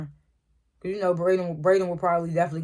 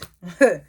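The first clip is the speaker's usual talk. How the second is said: quick amused laugh at herself; a brief chuckle at her own joke; short laugh; 6 dB louder than usual. Real, not acted. Vlogger laughing at herself squinting, short laugh.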